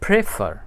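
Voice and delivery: प्रेफर divided as प्रे and फर; split into syllables. Shown as a demonstration. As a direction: say प्रेफर in two distinct syllables. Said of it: "तकसीम हो जाता है ना".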